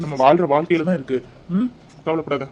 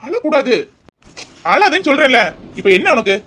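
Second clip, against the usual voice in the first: raised, higher exasperated voice; insistent scolding; 8 semitones higher, 6 dB louder than usual. Acted. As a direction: firmly order her to stop crying. Husband insists crying wife stop.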